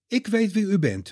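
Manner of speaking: eager call, pitched high. Acted. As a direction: call it out as if the answer is certain. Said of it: "roept hij". (enthousiast roepen)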